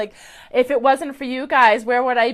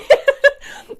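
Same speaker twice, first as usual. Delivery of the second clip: a sudden, much higher giggle; high embarrassed laugh; 6 dB louder than usual. Real, not acted. Psychic laughing at herself for crying on stream. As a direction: laugh off the tears.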